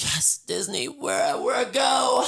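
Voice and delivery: high squealed mock thrill; retold excitement. Reacting to booking Disney for a birthday, excited squeal.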